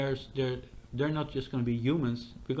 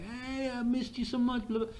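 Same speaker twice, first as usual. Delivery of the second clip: much higher, fond and sweet; gushing friend; 9 semitones higher than usual. Retold or imitated. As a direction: gush over a friend you missed. Friend greeting imitated.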